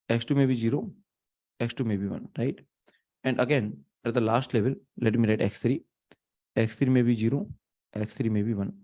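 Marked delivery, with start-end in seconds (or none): none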